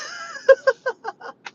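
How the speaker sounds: very high, loud laughter; laughing. Real, not acted. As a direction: burst out in a high cackle. Laughs at her own confession.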